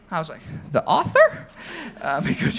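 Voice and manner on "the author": sharp, high, loud disbelieving yelp; stunned surprise. Retold or imitated. Retelling his reply when the famous author phoned him, stunned and surprised. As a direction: blurt it out in shock.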